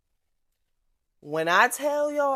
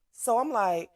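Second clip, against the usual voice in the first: normal talking voice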